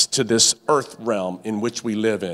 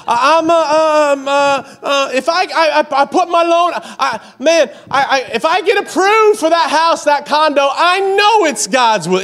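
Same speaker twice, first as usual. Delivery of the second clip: mock believer; 5 dB louder than usual. Imitates a stammering believer.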